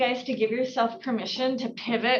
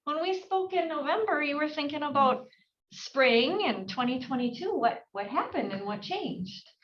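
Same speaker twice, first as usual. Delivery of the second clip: act shocked; 5 semitones higher than usual. Shown as a demonstration. Agent reacting to seller who put sale on hold, shocked. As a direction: play it like the news truly surprises you. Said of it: "you act shocked".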